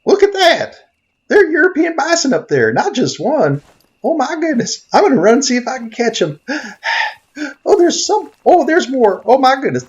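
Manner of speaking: high excited shouts of amazement; can't contain joy at the bison; squealing with excitement; thrilled. Real, not acted. Sees herd of bison.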